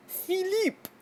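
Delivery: high-pitched "fee-LEEP" for PHILIPPE; French pronunciation. Shown as a demonstration. Vlogger trying out the storm's French name, high "fee-LEEP". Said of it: "is that French".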